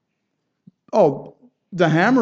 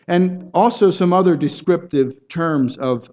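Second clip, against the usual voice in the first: usual speaking voice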